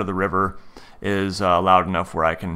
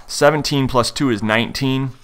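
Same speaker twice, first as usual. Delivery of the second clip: ordinary delivery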